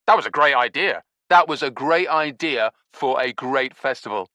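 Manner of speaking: pompous loud self-praise; Stinky to Nevada, loudly praising the stolen festival idea. Acted.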